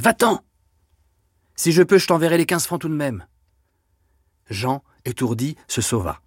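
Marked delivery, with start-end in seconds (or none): 0.0-3.3 high-pitched, hurried-sounding dismissal; anxious call in a raised voice; repeated urgent plea. A narrator's reading. Sister sending brother off with a promise, high and urgent. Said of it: "répétait Denise".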